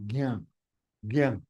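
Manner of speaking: gyam said softly as gaŋ; demo gyam. Shown as a demonstration. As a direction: model gyam gently. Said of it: "Now it is gyam".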